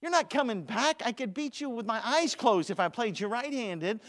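preacher taunting Jonathan at the table; teasing taunt. Retold or imitated. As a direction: rib the weak player. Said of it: "preacher taunting losing teen".

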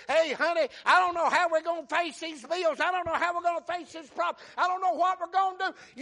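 loud, high preacher's imitation of worry; worked-up fretting. Spouse frets to honey over bills, loud worked-up voice.

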